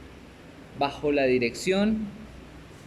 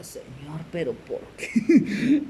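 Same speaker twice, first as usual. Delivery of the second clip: mock exasperated plea; much slower than usual. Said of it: "y yo decía".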